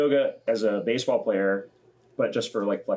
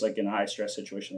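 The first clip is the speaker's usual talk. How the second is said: usual speaking voice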